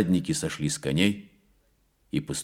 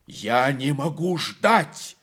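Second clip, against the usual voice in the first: higher, slow, insistent exclamation; cried out; 8 semitones higher, 4 dB louder, much slower than usual. A narrator's reading. The prince crying out at the locked gate, higher and slow. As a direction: cry it out, unable to wait. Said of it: "вскричал Серебряный".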